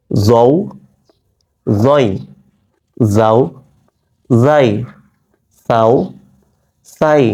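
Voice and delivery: জৌ জয় যাও যাই with the tongue tip touching the upper teeth; isolated syllables, each clearly set apart; tongue-tip sounds. Shown as a demonstration. Teacher demonstrating pronunciation to students, isolated syllables. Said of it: "এই উচ্চারণগুলো করছি আমি জিহ্বার আগা".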